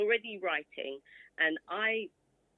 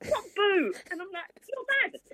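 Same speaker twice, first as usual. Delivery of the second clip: incredulous; 14 semitones higher than usual. Retold or imitated. Retold outrage at doctor.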